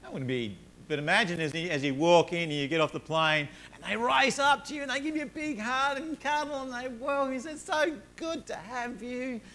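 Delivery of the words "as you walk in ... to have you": bright, high, excited storytelling. Pretend. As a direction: paint the joy of the welcome, voice soaring high. Joyful airport welcome scene.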